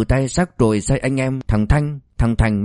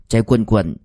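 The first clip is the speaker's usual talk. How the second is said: usual speaking voice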